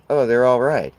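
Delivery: lightly voiced relieved mourner; mock relieved. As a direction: shrug it off like good news. Imagined relief at the news.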